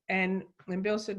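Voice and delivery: ordinary talk, nothing marked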